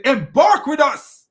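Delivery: high, loud, slow mock British; British accent. Shown as a demonstration. Host imitating British people who use this word, mock British accent. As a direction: have a go at posh-style British, drawn out. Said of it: "that guy can talk like British people".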